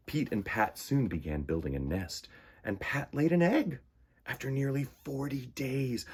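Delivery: gentle read-aloud storytelling; storybook narration. A narrator's reading. Reading a children's story.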